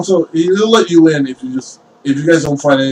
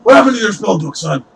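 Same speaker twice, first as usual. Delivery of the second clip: higher, exasperated father's question; father's reproach; 7 semitones higher than usual. Acted. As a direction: demand an answer from your son. Father questions his son.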